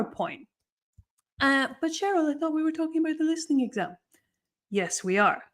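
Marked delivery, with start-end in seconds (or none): 1.4-3.9 higher, hurried protest from a student; quick high-pitched objection; puzzled student objection. Pretend. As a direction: play a student butting in, fast and high.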